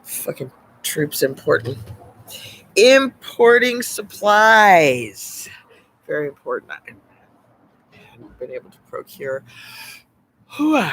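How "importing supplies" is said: drawn out. Real, not acted. Drawn-out mock announcement.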